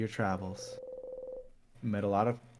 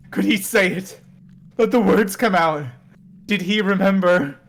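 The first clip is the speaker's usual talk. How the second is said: questions rising higher and louder; building dramatic narration; 6 semitones higher, 10 dB louder than usual. A narrator's reading. Narrator telling the player's story, rising dramatic build. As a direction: build it, each question more urgent.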